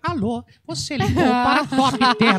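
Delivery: slow recorded receptionist voice; secretary voice. Retold or imitated. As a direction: announce it like a phone menu. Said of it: "fazer uma secretária dela".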